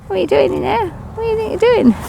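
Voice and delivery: high-pitched mock telling-off; mock scolding a bee. Real, not acted. Beekeeper finds a bee where it shouldn't be and mock-scolds it, high.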